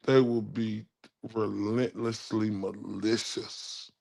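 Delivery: normal talking voice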